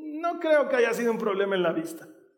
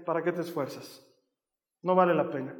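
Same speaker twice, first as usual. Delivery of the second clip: discouraging inner voice; 5 semitones lower, 5 dB quieter than usual. Acted. Voice of discouragement quoted.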